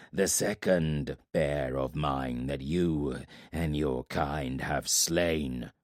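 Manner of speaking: low, very slow, heavy voice of a giant warlord; menacing warlord from the shadows. Acted. Giant warlord speaks to Corbin from the shadows, low and slow. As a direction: let each word drop slow and low like a giant's threat. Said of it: "a voice said from the shadows".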